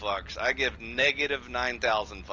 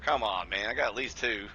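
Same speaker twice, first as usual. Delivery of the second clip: ordinary talk, nothing marked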